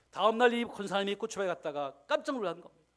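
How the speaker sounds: ordinary talk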